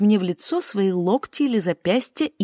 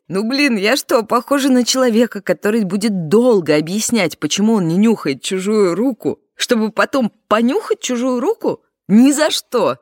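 exasperated; 5 semitones higher, 4 dB louder than usual. Acted. Exasperated refusal to sniff hand.

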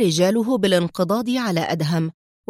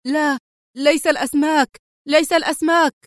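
high-pitched frantic pleading; terrified cry; 6 semitones higher, 3 dB louder than usual. A narrator's reading. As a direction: plead in acted terror, voice lifted high. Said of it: "راح الطيار يصرخ في رعب هائل". (صراخ مرعوب بنبرة عالية)

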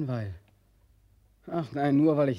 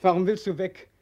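loud, higher call; 7 semitones higher, 7 dB louder than usual. Acted. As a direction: call after the one leaving. Calling after departing Elbe.

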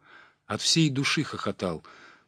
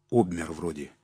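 ordinary delivery

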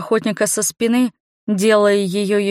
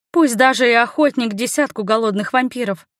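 ordinary delivery, nothing marked

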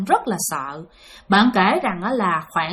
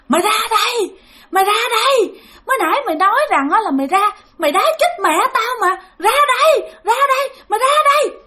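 eerie ghost voice; 12 semitones higher, faster than usual. Acted. Vengeful girl ghost taunts the driver to come out, high shrill. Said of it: "cái giọng rất kinh dị".

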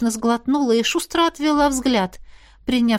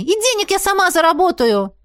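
high, rushed outburst; blurted in one breath; 9 semitones higher, 4 dB louder than usual. A narrator's reading. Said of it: "выпалила на одном дыхании". (выпалить на одном дыхании)

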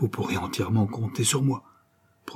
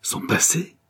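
a much higher breathless cry of dread; anguished cry; 9 semitones higher, slower than usual. A narrator's reading. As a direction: gasp it out in anguish. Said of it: "en un cri de terrible angoisse".

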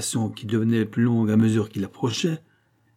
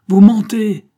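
loud, high accusing shout; furious acted outburst; angry cry; 11 semitones higher, 13 dB louder, slower than usual. A narrator's reading. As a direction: explode with accusation. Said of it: "s'écria la vieille fille".

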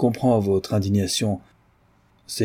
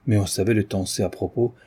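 plain reading voice

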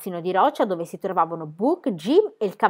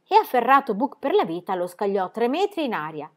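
ordinary delivery, nothing marked

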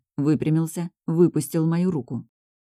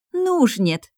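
high-pitched firm refusal; cutting refusal; 9 semitones higher, slower than usual. A narrator's reading. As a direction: cut the idea off at once. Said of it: "отрезала Ромара". (отрезая, категорично)

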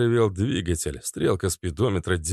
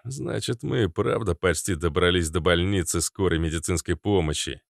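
ordinary delivery, nothing marked

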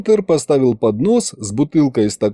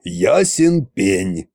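slow smug agreement; 4 semitones lower, much slower than usual. A narrator's reading. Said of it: "согласился Кощей".

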